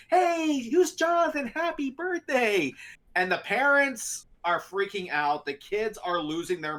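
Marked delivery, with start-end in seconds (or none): 0.1-2.7 high, bright-toned greeting, much higher; kids' show host. Retold or imitated. Steve greeting the birthday kid as a kids' show host. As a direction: sing it out like a children's TV host.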